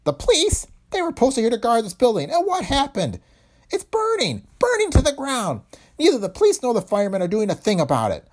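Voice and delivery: very high, loud despairing rant; outraged shout. A narrator's reading. Owner protesting police did nothing, high outraged shout. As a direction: wail at the injustice, pitch soaring. Heron keeps ranting at insurer.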